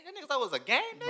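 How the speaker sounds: high-pitched incredulous taunt; mock taunt. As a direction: push the question way up high. Acting out villain's taunt.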